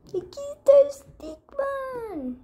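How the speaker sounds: slow, high presenting voice; high excited reveal. Pretend. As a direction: unveil it with delight. Presenting character grandly. (presentación aguda y emocionada)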